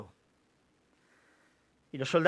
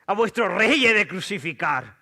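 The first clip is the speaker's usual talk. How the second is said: high, loud incredulous retort; loud challenge; 4 semitones higher, 6 dB louder than usual. A narrator's reading. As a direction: throw it back at the shouting crowd. Pilato replica a la turba. (réplica en voz alta)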